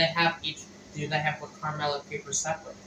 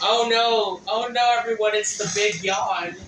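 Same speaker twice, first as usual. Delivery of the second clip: mock alarm; 6 semitones higher, 6 dB louder than usual. Mock alarm at partner's yawn.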